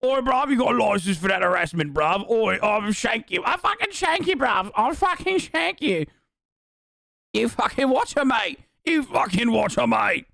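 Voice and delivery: high, hammed-up British outrage; mock British accent. Offended Brit threatening the streamer over Anglophobia, mock British accent. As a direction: threaten like an absurd angry Brit, pitched high. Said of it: "motherfuckers are like".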